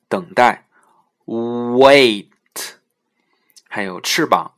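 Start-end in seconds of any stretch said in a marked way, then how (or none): 1.3-2.3 loud "wait" with a long oo onset and crisp t; careful word demo. Shown as a demonstration. Teacher giving examples of the w sound, loud slow demonstration. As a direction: stretch "wait" from oo and release the t. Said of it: "下面其他的一些例子".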